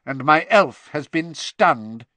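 slow, tight-lipped and firm; jerky, tight-lipped. A narrator's reading. Crouch explaining about his elf, tight-lipped. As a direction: barely move the lips, word by word. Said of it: "barely moving his lips".

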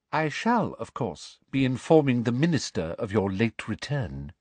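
high-pitched officious woman; cold, superior inquisitor; prim, cold official. Acted. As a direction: inform him with icy formality. Voices Umbridge.

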